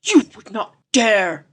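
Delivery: slow, breathy disbelief; breathed. A narrator's reading. Popham aghast at the professor, breathed. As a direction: breathe it out, slowly. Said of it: "breathed Popham".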